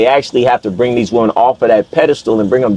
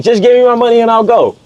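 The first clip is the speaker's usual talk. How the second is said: high, louder wailing plea; mock crying scream; 9 semitones higher than usual. Woman screaming for her money, mock crying plea. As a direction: shriek it like a fake crying hustler. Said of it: "She's screaming loud. She's crying loud".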